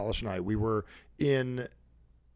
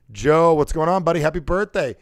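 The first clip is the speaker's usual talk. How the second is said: hearty, louder buddy greeting; 3 semitones higher, 8 dB louder than usual. Shown as a demonstration. Calling buddy Joe on his birthday, hearty. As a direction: hail your pal, less high-pitched. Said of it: "a different tone for different people".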